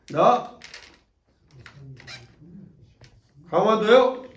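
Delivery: loud, gruff gangster reply; gruff loud answer. Retold or imitated. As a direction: bark it back like a tough guy. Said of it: "또 반말로 얘기해".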